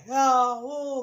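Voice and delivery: drawn-out mock wail, high and loud; hammed-up hurt child crying out; child's scream imitated. Shown as a demonstration. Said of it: "कैसे चिल्लाता है".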